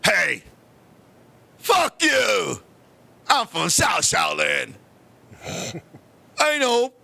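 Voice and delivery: low, slow, shouted kung fu movie challenge; deep, drawn-out mock Shaolin challenge. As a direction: hurl the insult like a film martial artist. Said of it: "I was like".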